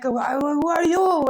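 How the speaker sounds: high, drawn-out demand; loud challenge. Retold or imitated. Challenging an unknown voice to name itself, loud and high. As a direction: raise the voice, stretching the question.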